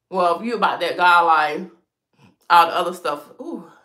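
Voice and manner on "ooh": ordinary talk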